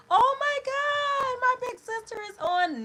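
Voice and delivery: suddenly thrilled to see her sister; excited squeal. Real, not acted. Speaker spots her big sister joining, excited squeal.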